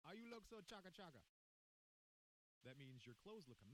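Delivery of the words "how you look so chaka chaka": light Jamaican patois lilt on the example; reading the patois example with a mild Jamaican accent. Shown as a demonstration. Reading Urban Dictionary's example to his cohost, mild Jamaican patois. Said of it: "Urban Dictionary, patois example".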